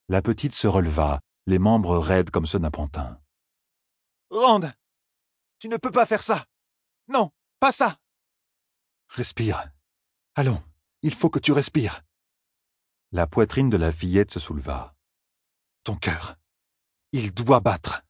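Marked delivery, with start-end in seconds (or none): 4.3-8.0 a very high, frantic begging voice; desperate to stop him; horrified plea. A narrator's reading. Moiraine begs Rand to stop raising the dead, very high and frantic. 9.1-18.0 put-on willing the child to breathe; urging Rand. A narrator's reading. Rand urges the corpse.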